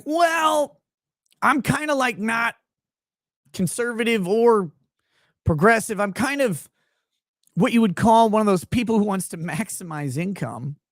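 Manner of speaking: high, loudish impression easing down gradually; mock hedging reply. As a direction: mimic someone squirming out of a label, starting high-pitched. Imitating the singer's reply to praise.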